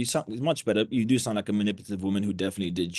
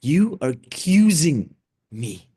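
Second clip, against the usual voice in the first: You are accusing me, slow, higher and louder; emphasis on key words; 6 semitones higher, 7 dB louder, slower than usual. Shown as a demonstration. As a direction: hit accusing hard. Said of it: "you need emphasis on those words".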